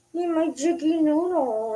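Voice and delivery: high, slow cooing to a pet; doting pet talk. Real, not acted. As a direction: coo it high and drawn out.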